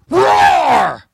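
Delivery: big high mock lion roar, loud. Preacher imitates lion roar.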